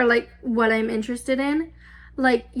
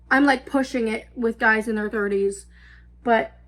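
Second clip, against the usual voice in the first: ordinary delivery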